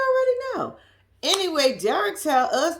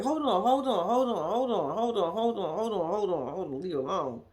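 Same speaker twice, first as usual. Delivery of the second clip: imitating the woman chanting hold on, fading softer; a light mimic of her stalling, trailing off; flustered repeated hold on; 4 semitones lower, 4 dB quieter than usual. Imitating the CO on the phone with Derek, fading hold on. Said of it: "And the way she said that".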